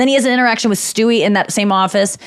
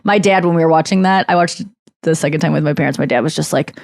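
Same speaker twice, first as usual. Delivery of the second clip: normal talking voice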